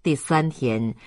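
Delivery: ordinary delivery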